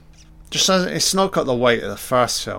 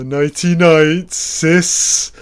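slow, high taunting sing-song; creepy taunt; 4 semitones higher, 6 dB louder, much slower than usual. Pretend. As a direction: drawl it like a villain. Voicing Freddy taunt.

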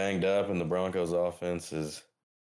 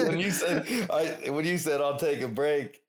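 laughing through the words. Real, not acted. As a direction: talk while cracking up. Laughing while quoting cohost.

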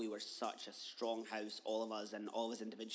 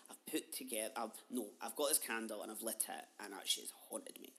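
normal talking voice

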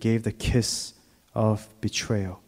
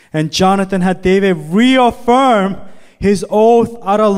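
scripture reading; 9 semitones higher, 8 dB louder than usual. Acted. Pastor reading the Bible passage to the congregation, raised reading voice. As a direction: read the text aloud to the church, lifting it up.

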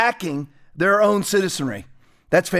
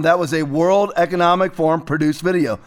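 ordinary talk

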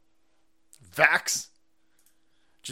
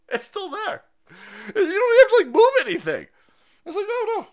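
mocking high voice; 6 dB louder than usual. Mocking climate talk, high voice.

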